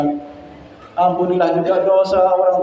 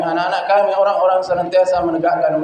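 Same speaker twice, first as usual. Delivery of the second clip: ordinary talk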